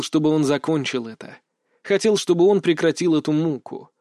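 usual speaking voice

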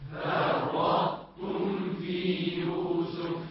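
distant group chant; faint repeat; class echoing from afar. Real, not acted. Students chanting back. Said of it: "طاء".

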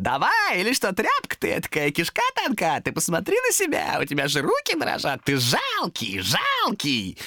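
high-pitched demon taunting; goading demon. A narrator's reading. Demon mocks Томас for trembling hands, goading. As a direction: needle him in a high creature voice. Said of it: "демон непрерывно его подзуживал".

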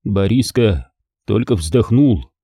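ordinary delivery, nothing marked

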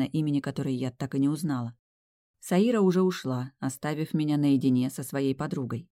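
plain reading voice

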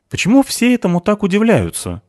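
high-pitched indignant question; annoyed. A narrator's reading. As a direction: ask it in cross exasperation. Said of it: "рассердилась Криспи". (рассерженно, на повышенном тоне)

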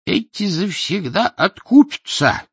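put-on high, slow, loud grumbling old man; old man's voice. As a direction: grumble like a spiteful old-timer. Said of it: "высказался третий голос, старческий". (ворчливый старческий голос)

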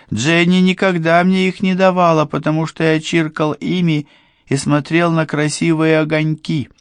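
высокий восторженный голос простака; взахлёб, по-детски радостно; восторженный простак. A narrator's reading. Morgan's excited childlike speech. (высокий детский голос простака)

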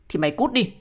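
loud, forceful order; angry dismissal. Acted. Ordering the partner to get lost, angry. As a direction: send them away with real bite.

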